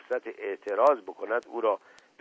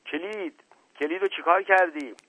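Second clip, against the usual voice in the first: high-pitched urgent demand; shouting; 8 semitones higher, 4 dB louder than usual. A narrator's reading. Husband demanding the roof key, shouting in a high voice. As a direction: pitch it up high, growing louder.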